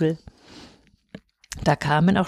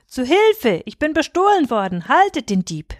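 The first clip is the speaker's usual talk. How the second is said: higher, raised alarm call; cry for help; 6 semitones higher than usual. A narrator's reading. Robbed merchant raises the alarm, higher crying out. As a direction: shout for help after a theft. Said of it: "eine Stimme schrie".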